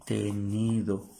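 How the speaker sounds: "Tenido" drawn out slowly, each syllable clear; slow demo. Shown as a demonstration. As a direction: spell out "Tenido" slowly for the class. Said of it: "Esto es en español". ("Tenido" dicho despacio, sílaba a sílaba)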